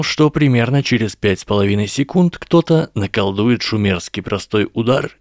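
plain reading voice

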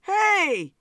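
high, loud yell for attention; screamed. A narrator's reading. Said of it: "he screamed".

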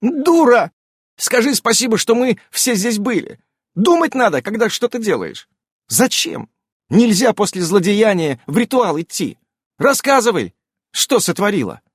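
high, half-shouted reproaches; hysterical scolding. A narrator's reading. Irene berates revived Ulyanka, near-hysterical scolding. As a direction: scold with relief and hysteria mixed. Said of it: "но и с истерическими нотками".

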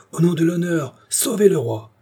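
high, urgent appeal to the soldiers; desperate cry. A narrator's reading. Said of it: "cria-t-il". (appel désespéré, crié d'une voix haute)

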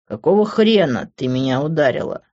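ordinary delivery

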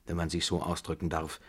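plain reading voice

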